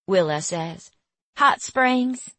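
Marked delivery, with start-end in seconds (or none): 1.3-2.3 high Southern twang; Southern accent. A narrator's reading. Girl adds her hometown, high Southern accent. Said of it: "her voice twangy with a southern accent".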